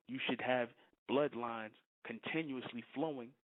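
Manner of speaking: ordinary delivery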